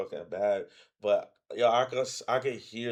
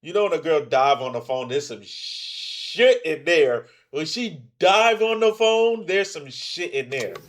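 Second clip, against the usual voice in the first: emphatic comic reaction; 4 semitones higher, 8 dB louder than usual. Real, not acted. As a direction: hammer the point home loudly, stretching it out. Emphatic reaction to phone revelation.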